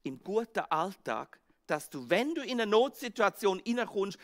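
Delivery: normal talking voice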